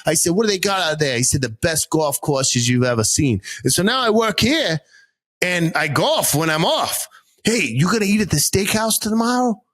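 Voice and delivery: thick Boston storytelling; Boston accent. Retold or imitated. Driver talking about his son, Boston accent. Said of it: "switch accents quick".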